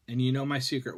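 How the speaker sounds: ordinary delivery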